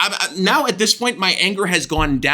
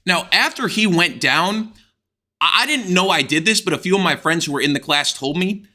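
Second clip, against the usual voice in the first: ordinary delivery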